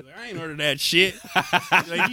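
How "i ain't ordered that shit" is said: quoting the homeowner through laughter; can barely get the quote out for laughing. Retold or imitated.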